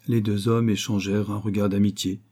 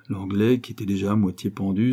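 ordinary delivery, nothing marked

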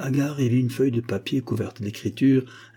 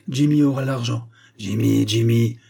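loud, scornful anger; furious sneer; 5 dB louder than usual. A narrator's reading. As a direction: sneer the name in fury. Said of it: "ricana Bark, furieux".

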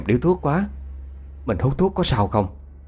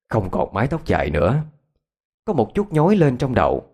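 ordinary delivery, nothing marked

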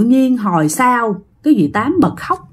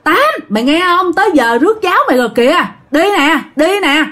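quick, higher-pitched insistent calling; calling again and again; 6 semitones higher, faster than usual. Retold or imitated. Neighbors keep calling the silent Aunt Tám, quick high insistent call. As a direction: hurry her up, calling impatiently. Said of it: "cứ đứng kêu hoài".

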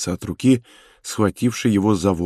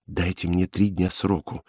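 soft, restrained request; quiet plea; 3 semitones lower, 8 dB quieter than usual. A narrator's reading. Prisoner begs inspector for time, quiet plea. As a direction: plead under your breath. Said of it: "сказал быстро и тихо".

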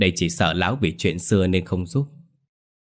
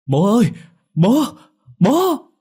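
much higher and louder desperate scream; anguished screaming; 8 dB louder, slower than usual. A narrator's reading. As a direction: scream after the father dragged away. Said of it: "gào thét". (gào thét thảm thiết)

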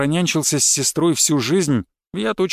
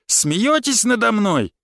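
indignant shout; 6 semitones higher, slower than usual. A narrator's reading. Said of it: "с криками понёсся".

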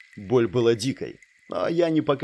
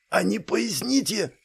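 hoarse wheeze; 8 semitones higher than usual. A narrator's reading. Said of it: "прохрипел я, захлёбываясь кровью".

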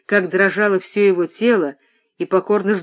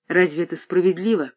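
ordinary delivery

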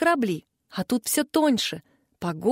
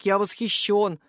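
slightly lowered rumbling voice; lightly voiced troll rumble; 3 semitones lower than usual. A narrator's reading. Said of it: "пророкотал Рэм".

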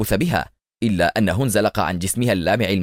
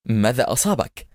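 ordinary delivery

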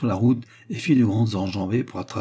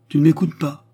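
louder, higher reproachful question; 6 semitones higher, 7 dB louder than usual. Acted. Mother to her inattentive son, reproachful. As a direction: call a daydreamer back to attention.

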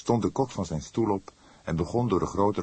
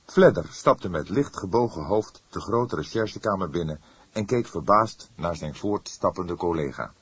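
ordinary delivery, nothing marked

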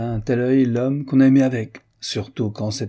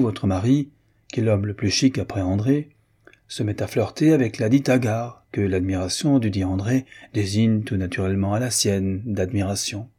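ordinary delivery, nothing marked

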